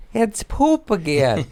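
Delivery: high, slow whine about poop; high whiny voice. Acted. To listeners, complaining of his stomach in a high, slow whine. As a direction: whimper it like a sick kid.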